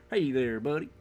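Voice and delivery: lightly mocked Southern greeting; Southern accent. Friend copying the other's greeting, imitated Southern accent. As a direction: echo it with a Southern twang. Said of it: "and you got Southern".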